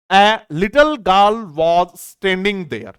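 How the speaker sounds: slow reading. Shown as a demonstration. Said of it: "और समझते हैं".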